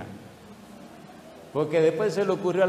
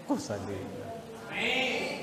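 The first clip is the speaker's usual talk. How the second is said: tender; 5 semitones higher, 8 dB quieter, much slower than usual. Real, not acted. Tender aside about his wife.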